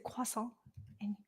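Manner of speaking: soft attempt at a French croissant; French accent. Shown as a demonstration. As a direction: say croissant softly the French way, final t silent. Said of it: "Can somebody correct my French?".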